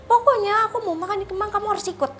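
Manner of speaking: high, loud, fast demand; mock bossy boyfriend. Boyfriend ordering her to come eat in Kemang, high and fast. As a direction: insist like a spoiled bully, pitched high.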